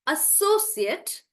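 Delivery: "associate" slow, stressed on SO; noun pronunciation. Shown as a demonstration. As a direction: stretch SO in "associate". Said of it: "I will pronounce them as".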